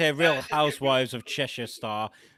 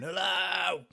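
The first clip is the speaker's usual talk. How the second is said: higher-pitched mock phone greeting; Dom Jolly; 6 semitones higher than usual. Imitating a prankster on a giant mobile phone, mock phone call. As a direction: draw out a silly high hello. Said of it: "Dom Jolly was just amazing with a giant mobile phone".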